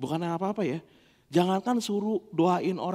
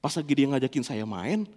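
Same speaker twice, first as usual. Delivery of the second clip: usual speaking voice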